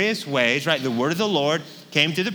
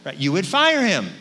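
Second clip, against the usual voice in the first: normal talking voice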